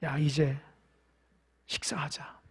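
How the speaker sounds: soft, low, hushed comfort; tender whisper. Acted. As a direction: comfort tired disciples in a near whisper. Jesus voiced inviting to eat. (낮고 부드럽게 속삭이듯 위로)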